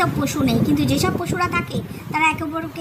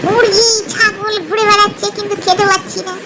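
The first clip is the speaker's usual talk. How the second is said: louder, higher fox sulking; a fed-up fox craving meat; grumbling hungry fox; 5 semitones higher, 6 dB louder, slower than usual. Acted. (জোরালো গলায় বিরক্ত ক্ষুধার্ত শিয়াল)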